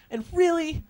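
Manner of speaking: way up high and bright; high excited hype. Acted. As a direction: squeal it out excitedly. Hyping the funny faces.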